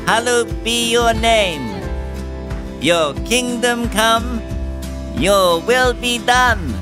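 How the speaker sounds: usual speaking voice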